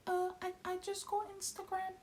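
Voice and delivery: very high, squeaky stammer; mock regular self. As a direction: squeak it hesitantly, mocking your own young voice. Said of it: "talking like my regular self".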